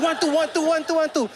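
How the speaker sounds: high, quick counting on the beat; rapid group count. Retold or imitated. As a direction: rattle off the count like a keen class. Class counts at doubled tempo.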